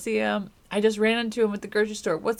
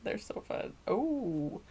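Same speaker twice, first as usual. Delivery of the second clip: ordinary delivery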